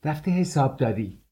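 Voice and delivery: a hint of mockery from the next room; mocking. A narrator's reading. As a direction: toss it off with mockery. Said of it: "صدایی مسخره آلود".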